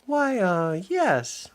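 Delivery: high, hesitant stammer; falter, unsure of granny. Acted. Minnie doubting the strange granny, high stammer.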